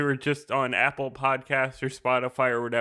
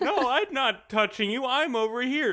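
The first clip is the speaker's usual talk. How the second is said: high-pitched mock denial; childish protest; 10 semitones higher than usual. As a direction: bicker back like an accused kid. Acts out childish bickering reply.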